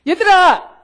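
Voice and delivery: loud sharp call; loud call to the teens. Retold or imitated. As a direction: yell to get the kids' attention. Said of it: "내가 그랬어요".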